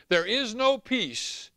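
"There is no peace" slow and loud; slow emphasis. Real, not acted. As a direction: lean hard on each word. Preaching emphasis.

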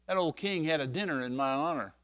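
ordinary delivery

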